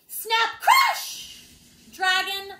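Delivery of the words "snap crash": much higher, loud sound-effect shout; shouted crash. Acted. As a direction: belt out the crash.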